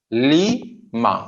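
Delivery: "Lima" split as Lee, pause, ma; two syllables. Shown as a demonstration. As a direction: separate the halves of "Lima". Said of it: "We have two". ("Lima" को ली और मा में तोड़कर)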